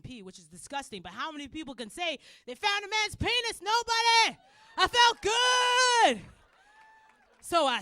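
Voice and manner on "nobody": normal talking voice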